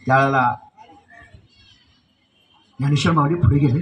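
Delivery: usual speaking voice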